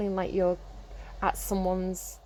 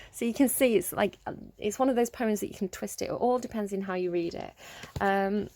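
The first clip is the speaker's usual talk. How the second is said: usual speaking voice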